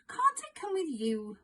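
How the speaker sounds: put-on high, begging child's plea; pleading. A narrator's reading. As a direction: beg to come along, voice up high. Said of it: "pleaded Ben".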